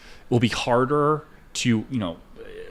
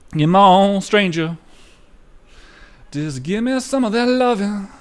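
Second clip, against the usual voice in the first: mock seductive coaxing; 10 semitones higher, 5 dB louder than usual. Coaxing the market.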